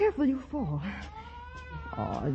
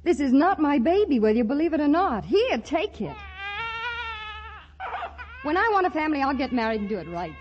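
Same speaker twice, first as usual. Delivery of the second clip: indignant protest; 5 semitones higher than usual. Acted. Polly denies the baby to the clerk, indignant and fast. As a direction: push back, offended and fast.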